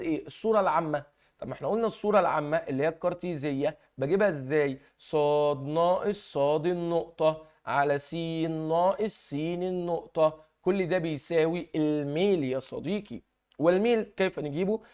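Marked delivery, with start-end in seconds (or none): 5.0-13.2 slow, deliberate dictation of the formula. Shown as a demonstration. Teacher to students, dictating the line formula, very slow. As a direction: spell the formula out word by word for students. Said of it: "teacher dictates formula slowly".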